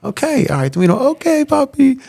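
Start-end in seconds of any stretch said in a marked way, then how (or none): 0.1-0.5 tender reassurance. Retold or imitated. Father soothing daughter. 1.1-2.0 imitating apologetic daughter. Daughter apologizing, voiced by father.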